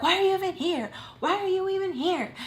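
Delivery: high-pitched retold angry demand; pissed. Daughter confronting mom who showed up, retold angry outburst. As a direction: send the pitch way up, re-enacting outrage. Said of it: "like, pissed".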